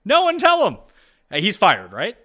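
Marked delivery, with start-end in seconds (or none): 0.0-0.8 very high, louder mock plea; high-pitched plea. Real, not acted. Co-host repeating the joke about the coach, high-pitched plea. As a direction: pitch it way up and push it out.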